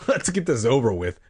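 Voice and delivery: ordinary delivery, nothing marked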